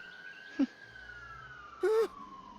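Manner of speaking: high, wild cackle; shrill cackle. Acted. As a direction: cackle like a mad undead. Zombie head cackles at paladin.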